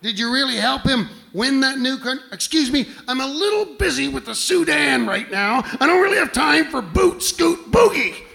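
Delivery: high, loud, put-upon Jesus voice; a busy, exasperated Jesus brushing off a question. Pretend. Jesus too busy to talk about country music, high and loud. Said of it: "imagine the interview with Jesus".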